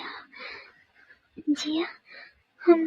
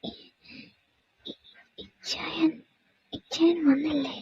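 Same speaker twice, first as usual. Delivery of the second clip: weak, halting; 3 semitones higher, 6 dB quieter than usual. Acted. Weak patient asks for husband.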